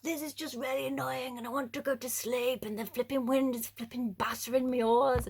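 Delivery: whiny, fed-up moaning; mock-annoyed disciple complaining; annoyed grumble. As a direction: grumble like a tired, soaked rower. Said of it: "I'd be like".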